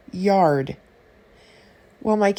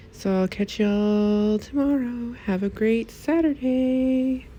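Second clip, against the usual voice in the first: sing-song sign-off; 8 semitones higher than usual. Real, not acted. Cheery sign-off to viewers.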